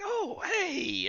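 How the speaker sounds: sudden high, bright hello; charmed friendly greeting. Acted. Charmed innkeeper greeting the party with a high eager hello. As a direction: greet them like old friends, pitch jumping up.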